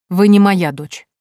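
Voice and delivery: a verdict thrown at her; cold fury. A narrator's reading. Count tells the girl she is not his daughter, furious.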